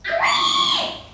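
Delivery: very high, loud piggy squeal. A narrator's reading. Trapped pig squealing in pain, very high loud squeal. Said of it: "he squealed".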